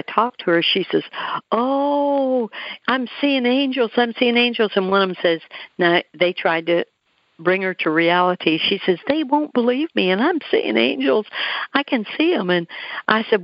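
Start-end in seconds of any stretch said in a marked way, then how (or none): 1.5-4.7 high, drawn-out "oh" of wonder; awed wonder. Retold or imitated. Dying woman telling of the angels she sees, awed wonder. 9.1-12.5 high-pitched retold insistence; insistent wonder. Dying woman insisting to her visitor she sees angels, insistent wonder.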